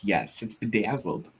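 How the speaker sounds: Southern twang slipping out, low and unforced; Southern accent slip. Real, not acted. Friend agreeing on a glittery chart, slight Southern accent. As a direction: let BEDAZZLED come out lightly Southern, low. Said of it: "there's that accent comes out".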